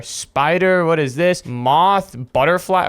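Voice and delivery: usual speaking voice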